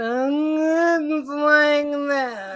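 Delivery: very slow, drawn-out sleepy mumble; slow-motion sleep talking. Retold or imitated. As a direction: drag every word out like talking in sleep. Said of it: "talking in slow motion in her sleep".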